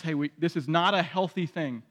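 a quiet firm boundary; gentle resolve fading softly to the end; firm but loving. Retold or imitated. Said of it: "a justice".

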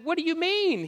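very high, puzzled question; baffled wife. Acted. Wife answering her husband's accusation, baffled. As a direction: pitch leaps up in innocent confusion. Wife's baffled reply acted out.

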